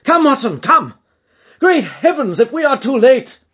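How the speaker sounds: loud, high, alarmed shouting; desperate full-voiced call; urgent cry. A narrator's reading. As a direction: shout for a companion to hurry. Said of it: "cried Holmes".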